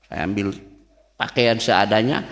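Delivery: ordinary talk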